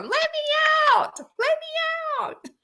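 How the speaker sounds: squawking parrot shriek; imitated parrot scream. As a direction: screech it like a parrot copying a trapped woman. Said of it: "Parrot's going".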